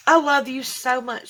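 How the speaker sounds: ordinary talk, nothing marked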